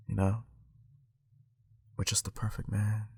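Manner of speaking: hushed, low spoken-word voice; soft, low, seductive poet delivery; Derek Jackson imitation. Portraying for viewers how he talks in his videos, low and hushed. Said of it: "I'm just portraying how he speaks".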